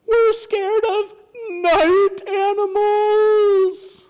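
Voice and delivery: very high, loud, drawn-out cry; storybook critters howling with fear; terrified animals' wail. Acted. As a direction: wail it slowly in hammed-up terror. Animals answer the bat in fright.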